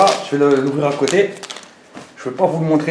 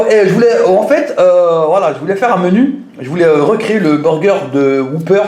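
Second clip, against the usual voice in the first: ordinary talk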